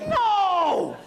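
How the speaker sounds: high, loud, drawn-out cry; exasperated shout. Acted. Tommy corrects friend about the hospital roof, exasperated shout. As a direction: belt it out in mock exasperation. Acted exasperated reply to misunderstanding.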